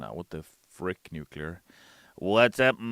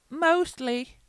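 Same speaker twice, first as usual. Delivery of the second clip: much higher squeak; squeaky aside; 7 dB louder than usual. Pretend. Admitting his sipping habit, squeaky and coy. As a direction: squeak it coyly. Joke afterthought.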